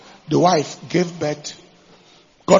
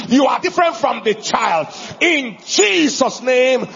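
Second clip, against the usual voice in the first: commanding shout; 5 semitones higher, 3 dB louder than usual. Retold or imitated. Man anointing the snake, shouting the command to leave. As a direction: cast it out with full force.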